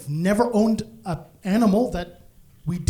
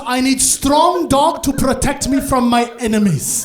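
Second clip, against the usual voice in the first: slow, loud, higher-pitched mom impression; imitated mom; 4 semitones higher, 6 dB louder than usual. Comic's mom explaining her dog test, slow forceful declaration. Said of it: "She goes".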